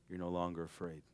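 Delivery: ordinary talk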